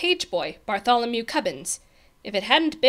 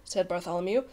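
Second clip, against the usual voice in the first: usual speaking voice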